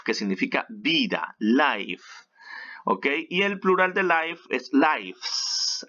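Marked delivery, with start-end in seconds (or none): 1.4-2.0 "life" drawn out as "laif"; noun pronunciation of life. Shown as a demonstration. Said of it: "Nosotros diremos life". 4.7-5.9 "lives" said slowly with an "ai" vowel; plural noun pronunciation. Shown as a demonstration. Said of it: "así".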